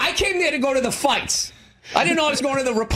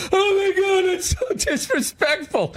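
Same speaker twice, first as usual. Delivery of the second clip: mock offended Trump fan; 8 semitones higher than usual. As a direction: play someone clutching pearls. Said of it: "They're like".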